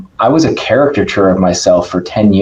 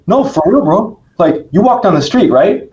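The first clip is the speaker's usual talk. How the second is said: a hint of a Brazilian accent, pitched higher; lightly imitating a Brazilian coach's accent, higher up; 10 semitones higher than usual. [name] teasing the speaker in a mock Brazilian accent. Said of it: "he's got a thick Brazilian accent".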